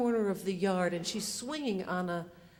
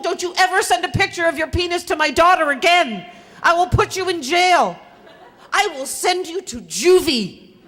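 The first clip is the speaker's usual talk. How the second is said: loud, higher, half-laughing threat; imagined angry-mom shout, breaking into laughter; furious threat; 7 semitones higher, 15 dB louder than usual. Retold or imitated. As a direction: shout it at the boy on the phone. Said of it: "get furious about this".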